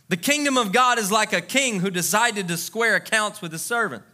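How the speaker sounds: usual speaking voice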